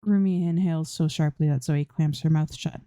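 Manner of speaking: usual speaking voice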